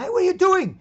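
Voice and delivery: high, loud startled shout; startled yell. Retold or imitated. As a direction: yell at someone wrecking your car. Startled car owner yelling.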